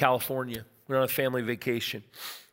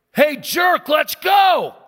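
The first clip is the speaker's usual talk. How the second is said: loud, high-pitched holler; acted road-rage shout; angry yell; 13 semitones higher, 9 dB louder, much slower than usual. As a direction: bellow it at the car in front. Angry driver yelling again, acted.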